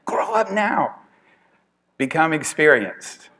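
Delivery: high mock-stern command to a baby; Will Ferrell bit. Quoting the skit again to the class, high mock command. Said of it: "you gotta find this".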